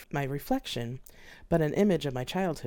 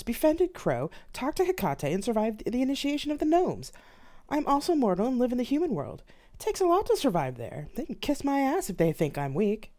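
building defiance; 8 semitones higher than usual. Acted. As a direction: wind yourself up line by line. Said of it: "a slow litany began in my head".

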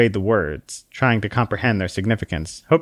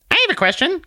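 very high, tiny squeaky voice; a tiny exasperated sprite scolding; squeaky little Conscience; faster than usual. Acted. Conscience snaps at the man in a very high squeak.

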